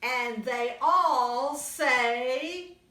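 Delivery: put-on lively read-aloud for little kids; storybook reading. A narrator's reading. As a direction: tell it brightly to a young audience. Reads picture book to children.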